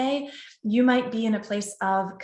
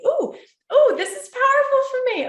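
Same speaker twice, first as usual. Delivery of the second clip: choking up; 6 dB louder than usual. Real, not acted. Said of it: "I'm gonna be choking up".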